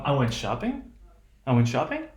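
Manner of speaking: "shopping" rising at the end, not done; rising intonation. Shown as a demonstration. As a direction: end "shopping" on an upturn, asking for confirmation. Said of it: "have rising intonation, which means".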